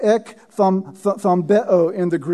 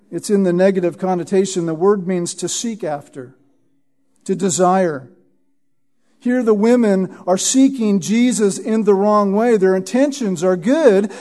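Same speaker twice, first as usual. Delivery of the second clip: ordinary delivery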